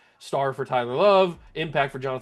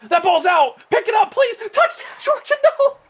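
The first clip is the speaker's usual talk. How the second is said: much higher, much louder panicked yells; frantic shouting; 9 dB louder than usual. Real, not acted. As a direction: scream at the screen as it all goes wrong. Fumble returned for touchdown.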